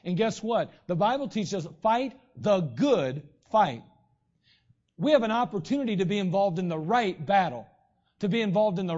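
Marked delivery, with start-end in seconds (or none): 1.8-3.8 "fight the good fight" said slowly, each word weighted; solemn slow preacher's refrain; slow emphatic. Shown as a demonstration. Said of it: "preacher drawls theme phrase".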